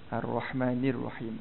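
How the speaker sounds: "Ar-Rahmanir Rahim" slow, low, clear "nir"; careful kasrah. Shown as a demonstration. Teacher models for students, careful "nir" in "Ar-Rahmanir". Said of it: "berhati-hati dari sudut sebutan tersebut".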